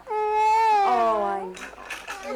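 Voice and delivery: tearful mother, voice breaking; crying. Real, not acted. Mother tearful as child leaves.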